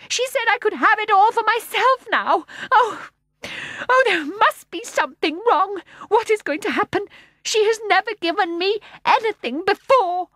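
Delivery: put-on high, loud distressed child; tearful boy. A narrator's reading. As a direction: plead through sobs. Michael crying after bursting into tears.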